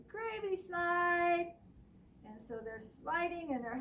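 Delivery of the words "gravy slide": high, slow gleeful call; excited mice cry. Acted. Mice shouting to each other, gleeful. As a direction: cheer it like a happy mouse. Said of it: "And they say".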